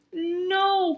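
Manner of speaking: high, loud wailed no; despairing cry. Acted. Skunk crying out as Bear wins, high and loud. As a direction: wail it in defeat. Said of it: "cried Skunk".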